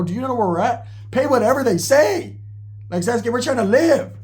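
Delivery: high-pitched, urgent retold plea; alarmed pleading. Alarmed plea to Roach in retold scene.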